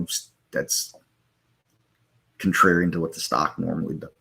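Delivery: talking through hiccups; hiccup-broken speech. Real, not acted. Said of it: "I have the hiccups".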